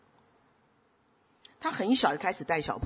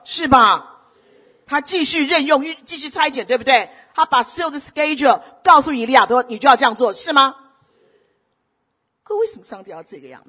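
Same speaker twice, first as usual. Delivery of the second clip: loud, rising, slow-building sermon climax; impassioned Chinese sermon push; preaching at full force; 7 semitones higher, 10 dB louder than usual. Acted. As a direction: preach it out, louder and higher.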